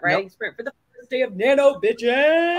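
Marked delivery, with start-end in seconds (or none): 1.4-2.6 hype cheer. Real, not acted. Hyped cheer for writing sprint.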